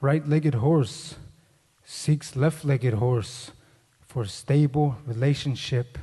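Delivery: low, slow deadpan reading; measured deadpan with pauses; joke read aloud. Acted. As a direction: read it out like a personal ad.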